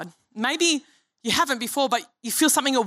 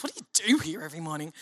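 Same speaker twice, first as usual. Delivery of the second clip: faster, lower gruff take on Gary; Gary's way of talking; 6 semitones lower, 5 dB quieter, faster than usual. Retold or imitated. Pastor mimicking Gary's quick question, lower and faster. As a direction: rattle it off like Gary. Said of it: "That's how he speaks".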